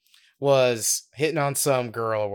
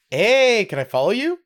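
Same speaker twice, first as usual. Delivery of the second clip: high, louder pick-up line rising at the end; imitated come-on; 9 semitones higher, 5 dB louder than usual. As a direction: play a forward stranger propositioning someone. Stranger propositions the buddy.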